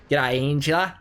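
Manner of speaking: light bogan Australian accent, mock greeting; chirpy Aussie twang on G'day; a hint of broad Australian, playful. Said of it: "kinda going".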